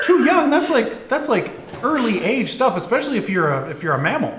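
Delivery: high, disbelieving goblin voice; incredulous goblin. Acted. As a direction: goblin shocked at the answer.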